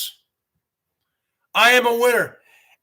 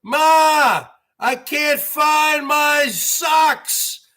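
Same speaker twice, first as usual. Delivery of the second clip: whiny yell for mom; 6 semitones higher, much slower than usual. Retold or imitated. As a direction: bawl for your mother like a helpless kid. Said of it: "I go".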